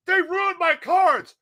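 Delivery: high, drawn-out complaint; wailed protest. Retold or imitated. Upset friend complains to teachers about cards, wailing. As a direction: imitate an aggrieved kid's wail.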